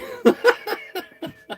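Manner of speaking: cracking up at own joke; high-pitched giggle fit; real laugh at own punchline; high burst of laughter. Real, not acted.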